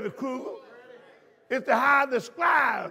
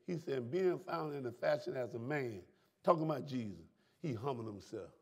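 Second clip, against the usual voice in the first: low, quiet preaching; 8 semitones lower, 8 dB quieter than usual. Acted. Preacher drops to quiet reading.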